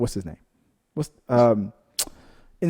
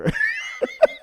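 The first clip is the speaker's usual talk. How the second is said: breathy laugh. Real, not acted. Joking about pummeling.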